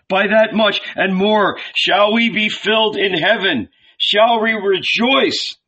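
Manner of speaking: high, loud fervent preacher; preaching. A narrator's reading. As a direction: proclaim with conviction. Jesus preaching.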